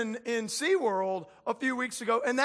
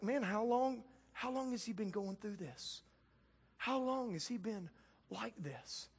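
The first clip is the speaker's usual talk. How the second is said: Jesus softly asking a hurting father how long, hushed; tender quiet Jesus; 3 semitones lower, 11 dB quieter than usual. Retold or imitated. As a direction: ask gently like a caring healer.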